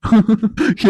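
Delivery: short amused laugh, a bit high; teasing chuckle. Acted. Man teasing the girl over her fright, amused chuckle. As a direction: laugh at her indignant denial.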